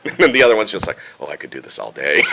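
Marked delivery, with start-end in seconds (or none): 1.2-2.2 deep, relaxed character voice; low, easy register. Acted. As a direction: settle down low and smooth. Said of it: "just right here".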